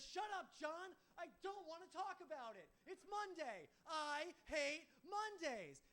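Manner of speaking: snapping, high-pitched furious yell; Garfield. Acted. Garfield to owner when confronted, furious snapping shout. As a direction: blow up, dragging out the last words. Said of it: "he just snaps at me".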